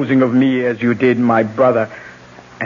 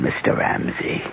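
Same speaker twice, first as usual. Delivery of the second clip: normal talking voice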